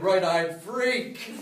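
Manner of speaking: slow, laughing punchline. Acted. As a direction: stretch it out with a laugh.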